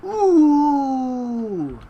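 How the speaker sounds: long, loud, high crowd "ooh"; high mocking "ooh" gliding slowly downward; taunting ooh. Onlookers egging on a drinking challenge, taunting drawn-out ooh. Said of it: "A taunting song of oohs".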